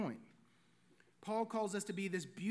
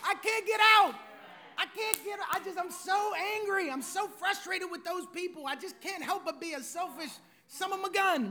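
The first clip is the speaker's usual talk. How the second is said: frustrated outburst; 6 semitones higher than usual. Acted. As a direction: vent it like someone stuck and fed up. Voicing a frustrated stuck believer.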